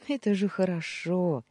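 ordinary delivery, nothing marked

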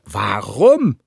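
gereizt und lauter. A narrator's reading. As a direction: kurz angebunden, gereizt. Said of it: "fragte Esch gereizt". (gereizte, laute Gegenfrage)

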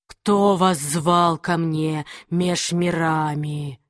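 low, slow demon voice; slow menacing demon from the void; demonic whisper. Acted. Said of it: "Голос демона напоминал треск разбитого стекла". (низкий медленный голос демона)

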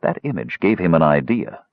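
usual speaking voice

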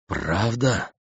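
soft stunned question; dumbfounded. A narrator's reading. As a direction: ask in disbelief. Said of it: "слегка остолбеневший Пелагон". (ошеломлённый вопрос)

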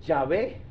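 YAHVÉ said low and soft, lifting at the end; low, tentative Yahvé rising on the last syllable; Yahvé as a query. Shown as a demonstration. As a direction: float Yahvé quietly with stress on the final VÉ. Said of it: "no llave".

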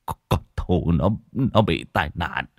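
halting, tearful stammer rising to distress; choked up. Acted. Husband telling his wife of the accident, choked up. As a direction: choke out the news, building to a cry. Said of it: "nhìn vợ mà nghẹn ngào".

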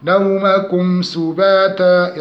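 slow نومكم سباتا with clean m; chanted نومكم سباتا, long vowels; clear meem. Shown as a demonstration. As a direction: close the m of نومكم before the s. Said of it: "إظهار الميم".

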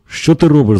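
frantic cry. A narrator's reading. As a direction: read a mildly frantic outcry. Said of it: "закричала медсестра не своїм голосом".

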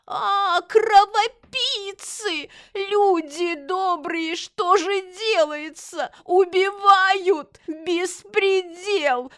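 high, drawn-out theatrical wail; heart-rending howl, very slow and high; hysterical wailing. Acted. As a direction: wail for help like a shameless old woman. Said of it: "бросилась к сыну и завопила".

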